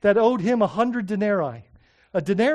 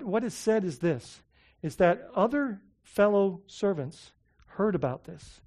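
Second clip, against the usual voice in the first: normal talking voice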